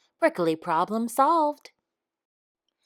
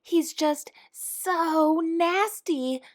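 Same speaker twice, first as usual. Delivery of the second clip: much higher, slow plaintive character voice; lizard complaining; 10 semitones higher, 3 dB louder, slower than usual. A narrator's reading. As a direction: whine about the bully. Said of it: "Liz cried".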